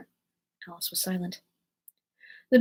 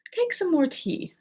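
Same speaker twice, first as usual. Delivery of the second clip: higher, slow earnest urging; 9 semitones higher, slower than usual. A narrator's reading. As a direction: press the offer earnestly, unhurried. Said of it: "said to Alice very earnestly".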